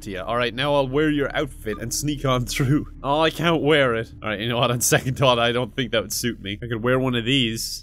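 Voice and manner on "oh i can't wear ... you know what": usual speaking voice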